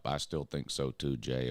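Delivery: ordinary talk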